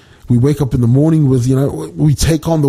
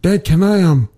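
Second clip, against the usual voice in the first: higher, lightly voiced child; little girl; 6 semitones higher than usual. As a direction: imitate a girl asking dad a favour. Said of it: "and says".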